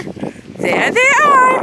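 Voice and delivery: slow stretched sing-song; drawn-out playful. Real, not acted.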